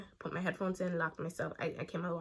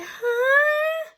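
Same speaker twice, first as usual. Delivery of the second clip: put-on dazed girl; 14 dB louder than usual. The girl wakes up confused.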